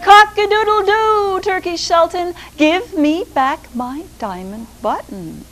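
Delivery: high, loud rooster crow and demand. Acted. Rooster calling up at the Sultan's window, high and loud crowing. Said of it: "And he went".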